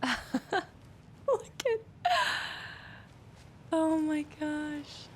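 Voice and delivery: high, slow laugh through the words. Real, not acted. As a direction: crack up at the sight. Laughing at the coffee cups.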